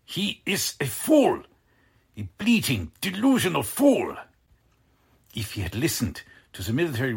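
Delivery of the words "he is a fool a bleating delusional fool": contempt. Acted. Officer denounces the Führer.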